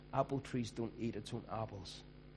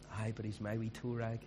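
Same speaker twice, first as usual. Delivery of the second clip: doting Scottish mum; 6 semitones higher, 6 dB louder than usual. Retold or imitated. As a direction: gush like a fond mum defending her boy. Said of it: "their mummy will go".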